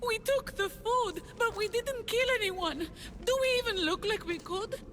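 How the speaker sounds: high, distressed protest of innocence; desperate pleading. Acted. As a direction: plead to be believed. Villager pleads innocence to armed stranger.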